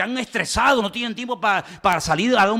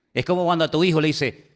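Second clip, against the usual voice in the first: ordinary delivery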